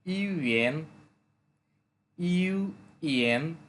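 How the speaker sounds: "UN" slowly split into ইউ and এন, with a gap; syllable by syllable. Shown as a demonstration. Teacher breaking the new word down for learners, "UN" syllable by syllable. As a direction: break "UN" in two slow, drawn-out parts.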